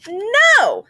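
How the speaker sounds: high, loud protesting "No"; a child's loud no; Sophie's loud no. Acted. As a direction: shout it like a child refusing. Sophie refuses, character line.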